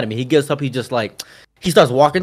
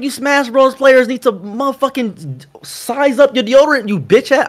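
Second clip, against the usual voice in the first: retold angry rant; 8 semitones higher than usual. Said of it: "I was so pissed".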